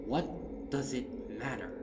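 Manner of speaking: a touch of defiant Rainbow Dash; Rainbow Dash speaking up. Acted. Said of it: "found her voice".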